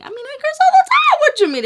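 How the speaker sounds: loud, very high-pitched squawk; playful defiance. Real, not acted. Vlogger joking with viewers about cursing, playful high squawk. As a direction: squawk it in mock indignation.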